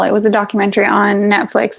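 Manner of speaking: ordinary talk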